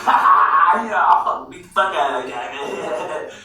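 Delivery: loud, high, laughing imitation; mock laughing boast. Imitating his friend bragging as they go inside, mock laughing. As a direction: laugh through a put-on tough-guy brag. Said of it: "he's like, laughing".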